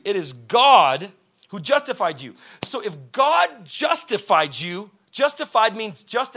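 loud, slow preaching; preaching at full force. Acted. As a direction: drive it home to the congregation. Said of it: "preacher emphasizing".

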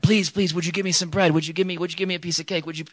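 high-pitched mock child's pleading; begging. Child begging dad for bread, mock pleading. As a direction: beg like a needy child, hammed-up. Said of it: "like, begging me, begging me".

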